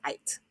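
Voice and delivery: IT misread as "ite"; mispronounced long vowel. Shown as a demonstration. Teacher showing what scholars would not say, mispronounced "ite". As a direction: say "it" with a long I, like "eye-t". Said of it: "would know not to say".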